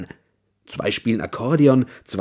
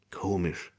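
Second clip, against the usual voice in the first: thinking to himself; 3 semitones lower, 9 dB quieter than usual. A narrator's reading. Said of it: "denkt Herr Hase".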